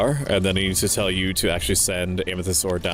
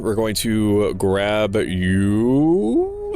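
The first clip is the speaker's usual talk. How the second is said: cute anime streamer; 4 dB louder, much slower than usual. Pretend. Drawn-out sing-song to game piece.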